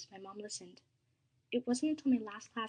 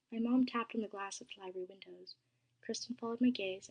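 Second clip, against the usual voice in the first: ordinary delivery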